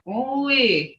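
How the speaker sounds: drawn-out falling ooh-wee; a fluttery high exclamation of amazement; impressed ooh-wee. Retold or imitated. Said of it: "they'd say".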